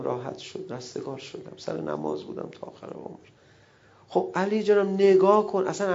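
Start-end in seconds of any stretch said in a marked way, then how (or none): 0.0-3.3 whisper. Pretend. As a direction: breathe it out in peace. Ali's quiet relief. (زمزمهٔ آرام)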